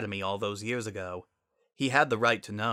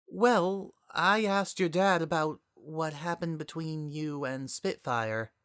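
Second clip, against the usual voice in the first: higher, nervous admission; hesitant Twilight; 7 semitones higher, slower than usual. Acted. Twilight admitting to Sunset what she asked, higher and nervous. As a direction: confess it carefully.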